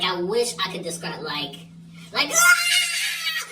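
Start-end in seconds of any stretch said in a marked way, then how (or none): 2.3-3.5 piercing high shriek; imitated scream. Friend screaming in pain, a high shriek. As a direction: let out a wild high scream. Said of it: "screaming like that".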